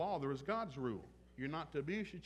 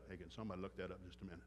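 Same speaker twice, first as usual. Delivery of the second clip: fast, much softer throwaway aside; quick aside; 6 semitones lower, 7 dB quieter, much faster than usual. Real, not acted. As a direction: toss it off quickly under your breath.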